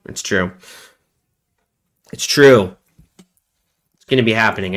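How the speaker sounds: normal talking voice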